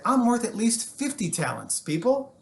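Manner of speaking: cocky boast. Acted. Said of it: "And he said".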